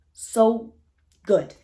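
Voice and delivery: a loud burst on so good; emphatic praise. Real, not acted. Reviewer hyping the suspenseful second half, loud and emphatic. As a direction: punch it out with enthusiasm. Building up The Stranger's ending.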